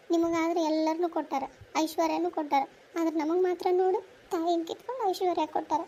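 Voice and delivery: normal talking voice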